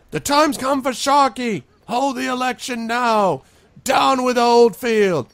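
high, loud mob chant, slowing to the last line; angry townsfolk shouting for an election; crowd shouts. Acted. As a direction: yell it from the back of the crowd. Crowd members shout demands.

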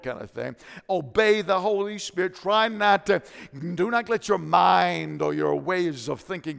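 loud, high, rising sermon climax; full-force preaching. Acted. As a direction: preach it out, shouting to the church.

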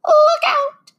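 very high, loud shriek fading away; dying witch shriek. A narrator's reading. The witch melts before Dorothy with a long high shriek. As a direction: shriek the warning, then let the last word melt away.

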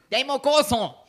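loud, high catchphrase; doña Mary. Shown as a demonstration. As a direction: call it out as a comic impersonation. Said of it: "hace a doña Mary".

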